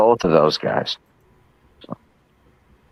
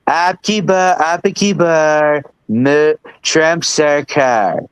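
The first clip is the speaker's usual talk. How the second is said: put-on slow, drawn-out American-accented Hindi; Trump's American accent; 2 semitones higher, much slower than usual. Mocking Trump's Hindi to Indian voters, slow American accent. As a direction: mangle the Hindi slogan like Trump, dragging every word. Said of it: "trying to read it in his own, you know, accent".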